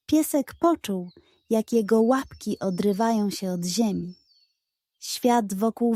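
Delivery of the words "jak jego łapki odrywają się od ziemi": ordinary delivery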